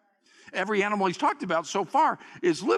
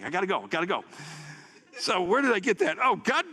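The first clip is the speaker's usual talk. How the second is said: normal talking voice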